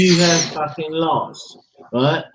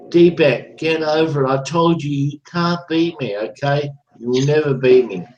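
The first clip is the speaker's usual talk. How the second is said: normal talking voice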